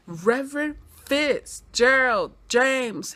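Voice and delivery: slow, drawn-out reveal of the name; slow dramatic name reveal. Real, not acted. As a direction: announce it like a twist.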